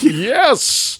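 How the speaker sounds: high, drawn-out sportscaster cry; Marv Albert. Acted. Interviewer caps his imitation of a famous sports call, exultant announcer. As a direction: crow it like a play-by-play announcer. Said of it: "like, Marv Albert".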